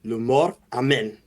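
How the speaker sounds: incantation. Acted. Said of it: "he says".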